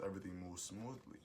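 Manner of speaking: usual speaking voice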